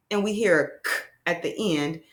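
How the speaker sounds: normal talking voice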